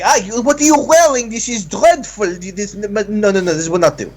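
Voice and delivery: high, appalled French couturier; disdainful tailor. Acted. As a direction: sniff at the outfit, scandalized. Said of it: "And they're like".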